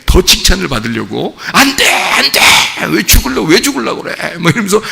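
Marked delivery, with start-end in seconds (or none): 1.5-4.4 Peter's protest. Retold or imitated. Peter protesting Jesus' death.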